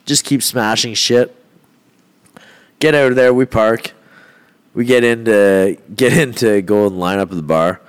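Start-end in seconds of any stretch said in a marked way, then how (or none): none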